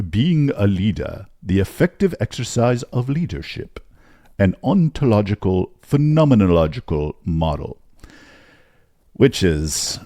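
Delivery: put-on posh, pompous British accent; stodgy British accent. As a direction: read it like a stuffy Oxford don. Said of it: "read it in my stodgy British accent".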